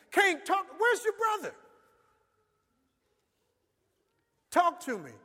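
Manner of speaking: loud high shout; shouting at Cain. Acted. God shouts at Cain to talk, loud shout. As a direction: demand it at full voice.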